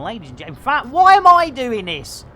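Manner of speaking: normal talking voice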